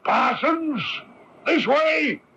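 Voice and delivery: calling out. Acted. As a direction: call the officers over from a distance. Constable calling officers from afar.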